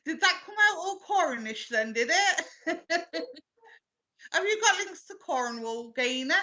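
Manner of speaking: high, lilting Cornish accent through laughter. Real, not acted. Medium surprised by her own accent asks sitter about Cornwall, laughing Cornish accent. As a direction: slip accidentally into a giggly Cornish accent. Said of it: "Sorry, that's not my accent".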